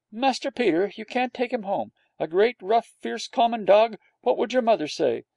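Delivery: disapproving nurse. A narrator's reading. Nurse forbidding the boy to take the dog, prim and fussy.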